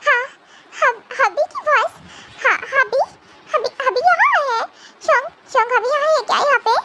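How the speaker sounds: put-on high, tearful little girl voice; a small girl sobbing for her husband; childlike crying girl. (रोती हुई बच्ची जैसी आवाज़)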